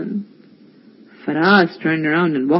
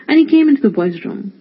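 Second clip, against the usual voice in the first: plain reading voice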